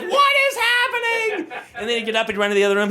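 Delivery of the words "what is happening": confused dog voice. Retold or imitated. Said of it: "He's like".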